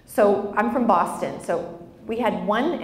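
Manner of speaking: ordinary delivery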